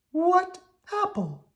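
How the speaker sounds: high, slow sweet worm voice; country cousin worm, polite. Acted. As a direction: ask very politely, pitched high. Squirmy puzzled.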